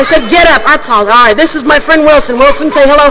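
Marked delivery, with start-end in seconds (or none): none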